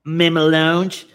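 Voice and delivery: loud, slow hyped ad read; ad announcer voice. Pretend. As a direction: sell it like a TV ad. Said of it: "un pequeño comercial".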